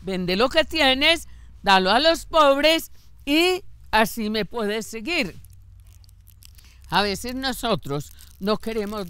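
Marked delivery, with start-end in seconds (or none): 0.0-5.4 the Lord's command. Retold or imitated. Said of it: "Y el Señor le dice".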